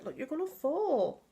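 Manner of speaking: high voice for the dog; talking to a pet. Real, not acted.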